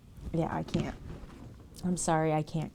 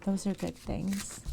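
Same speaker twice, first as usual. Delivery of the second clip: ordinary talk, nothing marked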